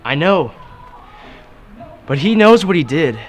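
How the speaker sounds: loud and exasperated, pitch up. Acted. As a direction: vent it, near shouting. Frustrated son venting to girlfriend.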